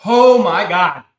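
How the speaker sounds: slow, loud, real burning pain; drawn-out pained cry. Real, not acted. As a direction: let it out slowly as the heat hits. Spicy chip pain hits him.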